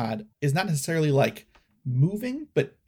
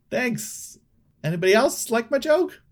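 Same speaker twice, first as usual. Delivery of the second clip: eager host; 11 semitones higher, 7 dB louder than usual. Acted. Toffee fishing for praise.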